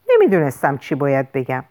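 ordinary delivery, nothing marked

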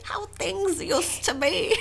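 high, slow creaky old-timer imitation; mock an old lady slowly; old person voice. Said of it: "Don't mock me in my old person voice".

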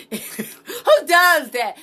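loud, high, drawn-out laughing disbelief; laughing incredulity. Real, not acted. Podcaster ridiculing retakes in live conversation, laughing in disbelief. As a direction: blurt it through laughter, stretching the words.

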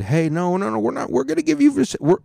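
very high, loud mock reassurance; squeaky, hammed-up official denial; mock government voice. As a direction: shoot up high to mimic a false promise. Mimics government reassuring people.

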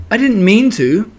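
high-pitched, mildly sorry apology; sorrowful. A narrator's reading. Pooh apologising for falling on Piglet, sorrowful. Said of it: "said Pooh sorrowfully".

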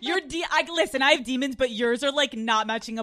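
loud, hammed-up hatred; loud comic rant; mock quarrel. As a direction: shout it like a fed-up lover. Acted lovers' quarrel over demons.

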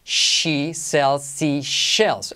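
very slow, each "sh" and "s" drawn out; "She sells seashells" word by word; slow tongue twister. Shown as a demonstration. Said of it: "Alternamos sh". (trabalenguas muy lento, marcando "sh")

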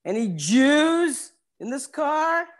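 high, drawn-out Texan call; big Texan. Acted. Texan stranger questioning a train car, high slow call. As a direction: play a big Texan calling down the carriage. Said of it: "blue-eyed Texan".

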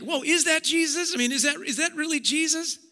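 high and quick, doubting wonder; can it really be him?; unsure amazement. Pretend. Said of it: "maybe you're like".

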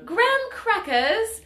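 high, drawn-out British teacher; mock British accent. Teacher listing snacks to her class, put-on British accent. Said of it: "she went into character when talking to her students".

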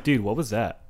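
low, easygoing question to a teammate; non-toxic. Retold or imitated. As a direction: ask it mildly, without any venom. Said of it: "I don't say it toxic as fuck".

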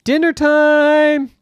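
long, high, loud call up the stairs. Acted. Mom calling her son down to dinner, loud drawn-out call. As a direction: sing out dinner time from downstairs.

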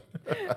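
a short, very high squealing laugh; high squeal of laughter. Real, not acted. Laughing at friend's teasing.

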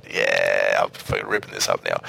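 deep, low cocky guitarist voice; cocky rock star. Retold or imitated. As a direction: growl it like a smug shredder. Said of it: "and just going".

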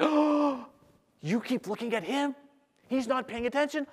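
high-pitched gasping disbelief; high, scandalised whisper-to-your-neighbour question; mock shocked realisation. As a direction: act out an astonished audience member. Audience member acted, startled realisation.

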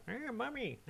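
put-on high, soft little-kid voice; mock small child. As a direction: imitate a toddler quietly calling for mum. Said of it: "He was going".

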